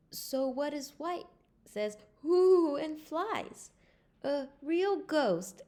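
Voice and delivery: high, loud, slow scared voices; frightened. Acted. Friends frightened by the ghost, high scared voices. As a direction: quaver with fear.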